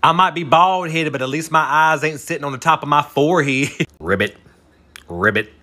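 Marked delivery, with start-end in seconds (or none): 4.0-5.5 low mock ribbit; frog croak. Mocking a woman as a bullfrog, low croaked ribbit. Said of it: "Hey, Bullfrog".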